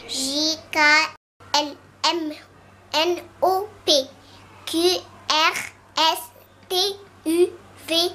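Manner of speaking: normal talking voice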